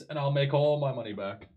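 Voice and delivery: ordinary talk, nothing marked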